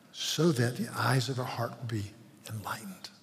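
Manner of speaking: softly whispered plea; whispered prayer. Real, not acted. Quiet daily prayer to the Lord.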